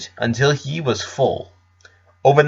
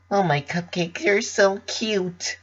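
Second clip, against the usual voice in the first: higher, softer, slow cooing; cooing over a baby; 8 semitones higher, 7 dB quieter, slower than usual. Acted. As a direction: melt over the cute newborn. Pinkie sees the baby alligator.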